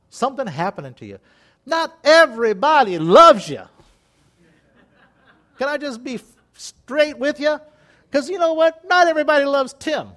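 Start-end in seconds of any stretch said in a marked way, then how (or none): 1.7-10.1 rising, louder, insistent; emphatic preaching. Real, not acted. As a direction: drive the point home hard.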